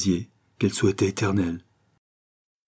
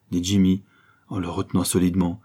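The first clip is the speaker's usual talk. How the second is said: usual speaking voice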